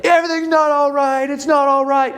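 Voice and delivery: a hammed-up frantic partner; panicked protest. Partner rejecting calm reassurance, imitated panic.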